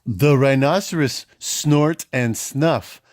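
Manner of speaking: ordinary delivery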